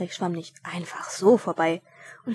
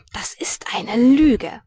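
a very slow, unvoiced furious whisper; angry whisper; 3 semitones higher, 8 dB louder, much slower than usual. Dwarf angrily whispering at the gossiping boy. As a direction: hiss it through the teeth, slowly. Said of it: "Der Zwerg sah ihn wütend an".

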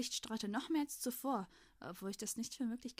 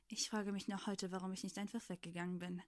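ordinary delivery, nothing marked

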